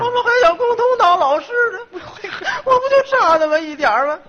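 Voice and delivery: pianto finto, più acuto, ridendo; mock crying. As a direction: lamento esagerato da commedia. Fake crying over exam.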